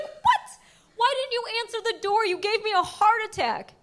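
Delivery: squeaky high "What?" then disbelief; shocked outburst. Retold or imitated. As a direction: jump up in pitch, caught off guard. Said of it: "And I was like".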